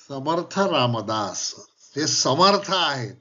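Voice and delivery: usual speaking voice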